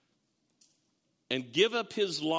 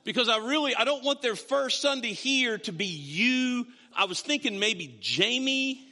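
high, louder mock-earnest voice; acted-out awkward explanation, pitched up; imagined tactless churchgoer; 6 semitones higher, 4 dB louder than usual. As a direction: play a churchgoer bluntly hinting. Imagined churchgoer tactlessly avoiding his preaching.

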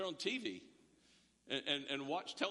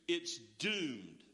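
gloomy verdict; 6 semitones lower than usual. Retold or imitated. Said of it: "would look at the current student body and go".

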